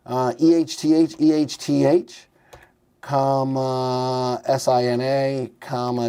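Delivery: normal talking voice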